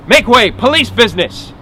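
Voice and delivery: high, loud official call. Acted. Marshal pushes through hollering crowd, calling out with authority. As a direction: clear a path with your voice.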